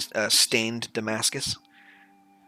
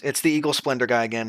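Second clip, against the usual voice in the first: usual speaking voice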